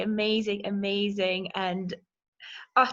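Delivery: ordinary delivery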